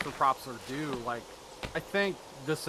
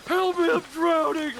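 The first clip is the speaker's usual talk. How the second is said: high mock cry for help; drowning; 12 semitones higher, 4 dB louder than usual. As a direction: wail it like you're sinking in a puddle.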